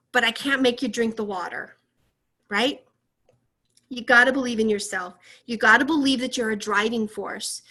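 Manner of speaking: ordinary delivery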